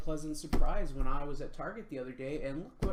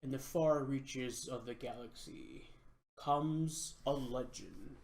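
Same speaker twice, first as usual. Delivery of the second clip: epic fantasy narrator; 2 semitones lower, slower than usual. Acted. Dramatic cartoon intro narration.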